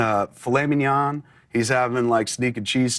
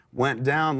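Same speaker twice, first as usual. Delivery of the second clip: ordinary talk, nothing marked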